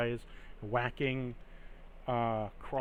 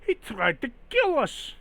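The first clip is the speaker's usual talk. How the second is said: higher, louder objection; alarmed villager; 10 semitones higher, 4 dB louder than usual. Acted. Villager answers Gunther about the bear, higher alarmed retort. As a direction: protest in disbelief.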